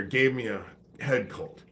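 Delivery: usual speaking voice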